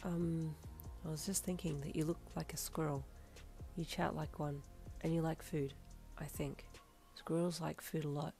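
soft, absent-minded musing; blank, detached. A narrator's reading. Moichiro comparing his companion to a squirrel, detached. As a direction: think aloud quietly, without expression. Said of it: "eyes just staring blankly at you".

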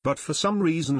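ordinary delivery, nothing marked

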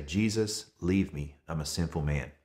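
a kneeling fisherman quietly confessing to Jesus; humbled plea. Retold or imitated. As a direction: sink into a hushed, humbled confession. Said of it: "he falls to his knees".

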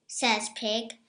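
usual speaking voice

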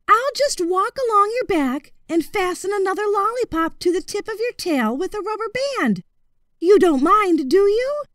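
put-on high, courteous young voice; boy's high voice. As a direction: politely turn the crocodile down, voiced high.

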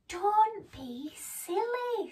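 high, slow, drawn-out chiding; mock scolding. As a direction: stretch it out to tease a silly friend. Nibbles scolds the frightened mouse.

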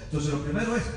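ordinary talk, nothing marked

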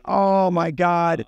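long, drawn-out dismay. Retold or imitated. Car owner learns his downpipe won't fit, drawn-out dismay. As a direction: stretch every word out in despair. Left holding a useless part.

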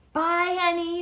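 high, loud, affectionate farewell; fond goodbye. A narrator's reading. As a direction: say goodbye to a beloved animal. Annie kisses the giraffe goodbye.

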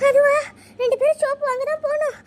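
panting from running; out of breath. Acted. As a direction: gasp it out between breaths. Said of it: "சரி, சரி, மூச்சு வாங்கு முதல்ல".

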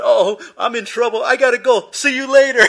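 high, loud, frantic yelling; hammed-up alarm, bolting off; comic panic. Bitten boy abandons girlfriend to run home, panicked.

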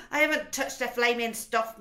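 loud angry protest; shouting. A narrator's reading. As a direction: shout the denial. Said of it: "Mister Wormwood shouted".

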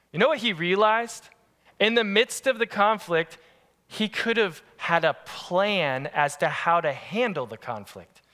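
usual speaking voice

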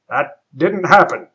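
a hint of a stern captain; insist, with mild captain bravado; insistent captain. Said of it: "insisted the man".

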